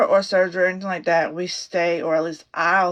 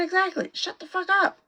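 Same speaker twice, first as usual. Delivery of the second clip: 1 mock snap; 13 semitones higher than usual. Retold snapping at husband.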